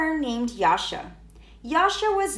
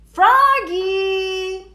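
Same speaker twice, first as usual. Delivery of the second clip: louder, higher shout; calling out; 7 semitones higher, 7 dB louder than usual. A narrator's reading. The director calls Froggy across the lake, loud. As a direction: call him across the lake. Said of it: "called Mister Mugwort".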